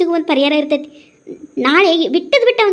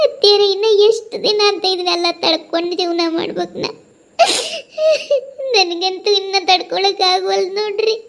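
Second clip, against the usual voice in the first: sobbing, worn-out complaint; crying at the end of endurance; tearful despair. Acted. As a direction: lament to your mother-in-law between sobs. Acted breakdown to mother-in-law.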